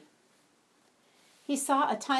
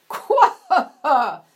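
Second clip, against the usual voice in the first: much louder booming frog guffaw; loud croaking laugh; 6 semitones higher, 14 dB louder, slower than usual. Acted. Frog laughs loudly at the animals from the mushroom, booming croak. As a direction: laugh big like a fat frog. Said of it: "said the frog".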